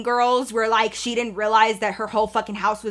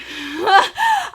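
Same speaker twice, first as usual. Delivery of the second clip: burst of laughter; 7 semitones higher than usual. Real, not acted. Laughing at her own question.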